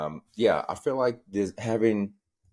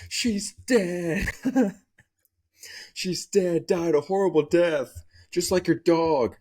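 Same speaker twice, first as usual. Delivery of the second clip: pitched way up, odd timbre, slow deadpan; high creepy voice; 11 semitones higher, slower than usual. Retold or imitated. Uncle telling his niece bad news, high creepy voice. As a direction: announce doom in a strange high voice.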